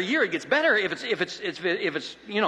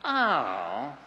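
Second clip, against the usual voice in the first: slow, deflating sneer; drawn-out, dismissive wife; disappointed put-down; 5 semitones lower, much slower than usual. Retold or imitated. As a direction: belittle his paycheck slowly. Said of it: "look at it and say this".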